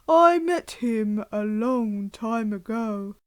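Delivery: slow, heavy voice of the big Gruffalo father; gruff Gruffalo dad. Acted. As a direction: warn the child like a solemn monster dad.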